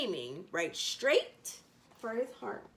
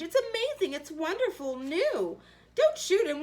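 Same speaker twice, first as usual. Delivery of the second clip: amazed, high; 7 semitones higher, 3 dB louder than usual. Acted. Hunters amazed.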